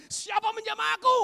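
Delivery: high, loud acted call; loud demanding question. Jesus asking the crowd who touched him, loud demand.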